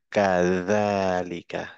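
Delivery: Arabic recitation model; Arabic reading. Shown as a demonstration. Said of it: "উচ্চারণ হচ্ছে দা".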